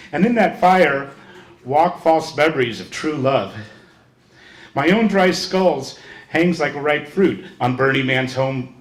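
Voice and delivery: measured poetry reading with punched openings; poem recitation. Acted. Reader performing a friend's poem to an audience, poem recitation. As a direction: declaim it line by line, letting endings fall.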